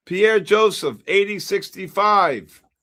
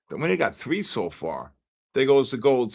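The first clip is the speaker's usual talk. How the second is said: normal talking voice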